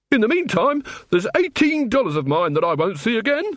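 high, sharp complaint. A narrator's reading. Robbed man turns back to business, sharp. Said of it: "he said sharply".

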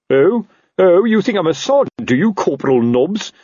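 high, indignant challenge; loud, offended officer; affronted captain. Acted. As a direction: pounce on the underling's slip. Captain rounds on corporal's slip.

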